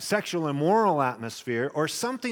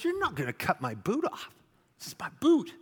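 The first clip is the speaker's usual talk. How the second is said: indignant refusal; 6 semitones higher than usual. Retold or imitated. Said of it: "I said".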